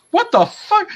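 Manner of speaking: very high, loud incredulous squawk; mock outrage. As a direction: imitate a scandalized vampire. Retelling vampire's outraged reply.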